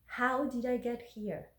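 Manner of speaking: ordinary talk, nothing marked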